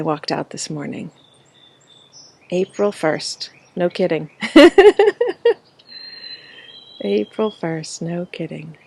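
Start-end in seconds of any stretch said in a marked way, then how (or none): 4.4-5.5 high, loud giggle; laughing. Real, not acted. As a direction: burst into a real high-pitched laugh. Laughs at own April first remark.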